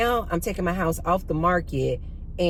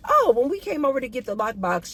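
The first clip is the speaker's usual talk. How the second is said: nonchalant, cavalier; 7 semitones higher than usual. Retold or imitated. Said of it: "all casual and nonchalant and cavalier".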